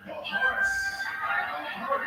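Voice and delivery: high excited call-out. Real, not acted. Host calls card flip.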